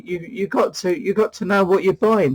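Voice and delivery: usual speaking voice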